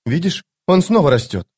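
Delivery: put-on high-pitched girl pointing something out; young woman's voice. A narrator's reading. Girl points at growing block. (высокий девичий голос)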